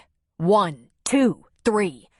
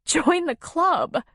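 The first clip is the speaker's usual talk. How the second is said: much higher, very slow wry reply; wry shaken woman; 10 semitones higher, much slower than usual. Acted. Woman replies to him after fright, wry. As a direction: answer with shaky humor.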